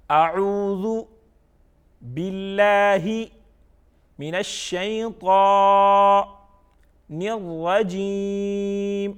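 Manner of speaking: slow, loud chant broken into parts; recitation by parts. Shown as a demonstration. Quran teacher reads it by parts for pupils, slow chant. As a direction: recite piece by piece, holding the long vowels. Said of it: "by parts پڑھ لیا".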